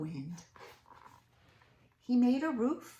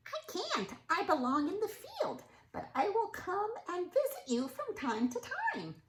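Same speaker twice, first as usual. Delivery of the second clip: high, lightly squeaky mouse; a small high mouse voice; field mouse voice; 10 semitones higher than usual. Acted. Field mouse politely turning Stanley down, high and squeaky. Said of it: "said the field mouse".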